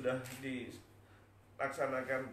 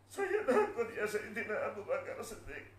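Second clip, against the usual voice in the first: very high, acted sobbing; tearful fear; 8 semitones higher than usual. Bilal pleading to Abu Bakar, sobbing in fear. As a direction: cry out, then sink a little. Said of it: "Mengapa kamu menangis seperti ini".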